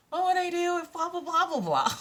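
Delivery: high-pitched spiralling blah-blah; mock panic. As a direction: spin worries up into high, silly babble. Mocking her own spiralling worries.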